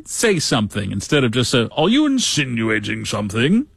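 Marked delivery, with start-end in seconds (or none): 1.8-3.7 a hint of a touchy drunk; mock drunk suspicion. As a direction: play the offended drunk lightly. Voicing the drunk slapped candidate.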